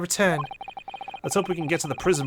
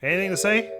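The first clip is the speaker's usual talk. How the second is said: ordinary talk, nothing marked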